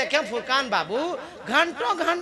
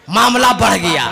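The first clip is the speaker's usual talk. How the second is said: ordinary talk, nothing marked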